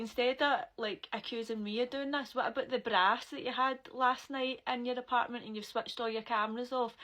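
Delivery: a loud retold challenge to Sam; retold loud counter-accusation. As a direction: turn the blame back on him loudly.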